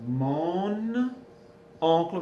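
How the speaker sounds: Mon oncle linked as "mo-noncle", the n carried over; liaison. Shown as a demonstration. Teacher modeling mon oncle for students, liaison. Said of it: "we're gonna have the liaison".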